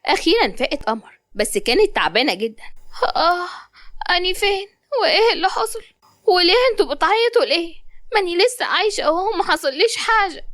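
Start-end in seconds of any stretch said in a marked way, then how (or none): 3.1-10.4 put-on high girlish voice, just waking, a little confused; a young woman coming round in hospital; waking patient. Qamar waking in hospital asks what happened, dazed and high.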